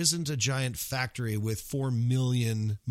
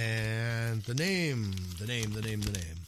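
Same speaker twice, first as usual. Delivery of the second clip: suspense build; 3 semitones lower than usual. Pretend. Drumroll before announcing winner.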